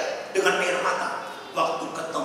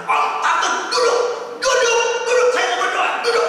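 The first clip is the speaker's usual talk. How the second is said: sharp, high, loud orders; commanding shout; 9 dB louder than usual. Retold or imitated. Pastor ordering the quarrelling couple to sit, loud and high.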